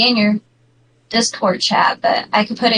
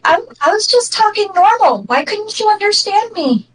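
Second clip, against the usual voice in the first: higher, protesting fast-talker voice; puzzled fast talker; 9 semitones higher than usual. Acted. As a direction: plead bafflement, pitched up throughout. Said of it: "where you just talk so fast".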